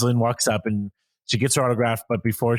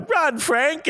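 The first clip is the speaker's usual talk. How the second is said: urgent mock call; 14 semitones higher than usual. Acting out an urgent movie moment.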